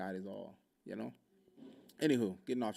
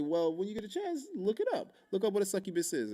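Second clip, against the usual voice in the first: pitched-up, pointed brush-off; mock-helpful retort in a raised voice; retold parting retort; 9 semitones higher, 3 dB louder than usual. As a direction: toss it back high, as a retort.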